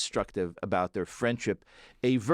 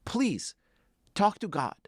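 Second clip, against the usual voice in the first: pitched-up desperate plea; begging the river; 6 semitones higher than usual. Retold or imitated. Elazar begs the river to talk to God, high desperate pleading. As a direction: plead with the river like your life depends on it. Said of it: "he goes to the river and he says".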